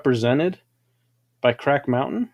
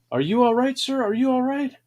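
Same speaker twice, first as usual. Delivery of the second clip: worried nurse; 9 semitones higher, faster than usual. Retold or imitated. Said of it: "And she said".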